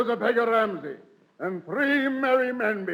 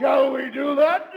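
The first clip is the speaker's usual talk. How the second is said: put-on rowdy drunken shout; 4 semitones higher, 6 dB louder than usual. Sir Toby to his drinking mates, roaring for a catch. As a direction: shout the question out, higher and louder.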